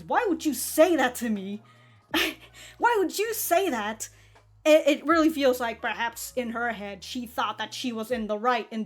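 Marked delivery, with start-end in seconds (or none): 0.0-4.1 exaggerated indignation with swooping pitch on the question; mock indignant. Viewer acting out Mika's response to the teasing, mock indignant. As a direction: play up being affronted, pitch leaping up.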